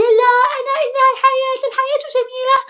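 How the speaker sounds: very high squeaky mock girl voice, partly rushed; mocking naive girl. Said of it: "ما تقوليش لي".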